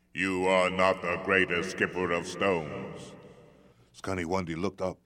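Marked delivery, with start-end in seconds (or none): 0.1-3.1 big booming giant, mocking; stone giant voice. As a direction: loom over him and scoff. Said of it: "a great voice".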